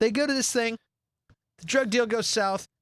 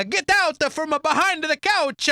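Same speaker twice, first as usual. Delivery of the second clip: mock Italian mobster; 9 semitones higher, 5 dB louder, slower than usual. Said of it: "says it in Italian".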